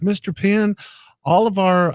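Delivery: polite receptionist. Retold or imitated. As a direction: mimic a courteous salon girl addressing a client. Imitating receptionist addressing a star client.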